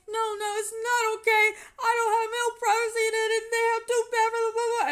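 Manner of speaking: high, loud tearful shouting; pouring out anger through tears; going off. Retold or imitated. Yelling at the house manager about the bathroom, high and tearful. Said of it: "And I'm going off".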